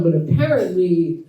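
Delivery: usual speaking voice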